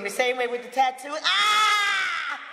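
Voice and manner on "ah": sharp high shouted scream; retold scream. Recalling how she screamed after the call, a sharp scream. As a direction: let out a short raw scream. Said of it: "All I could do was scream".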